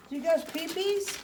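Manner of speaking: high-pitched question to puppies. Real, not acted. Baby-talking to the puppies.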